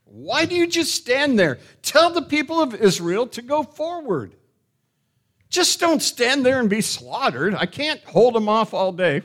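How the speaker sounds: high, loud, exasperated scolding; chastising. Acted. The Lord ordering Moses to move the people, chastising. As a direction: push him to act with an urgent rebuke. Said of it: "It's kind of chastising him a little bit".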